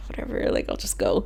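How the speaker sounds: soft unvoiced whisper, giving up; whispered resignation. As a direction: whisper it to yourself, defeated. Retold resigned thought to herself.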